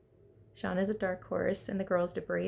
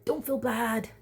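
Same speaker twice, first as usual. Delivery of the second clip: higher, louder, slow emphatic cheering on; rally your friend like a hype girl; hyped girlfriend pep talk; 5 semitones higher, 4 dB louder, slower than usual. Retold or imitated. Vlogger urging Robin not to feel bad, loud encouragement. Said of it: "And it's like".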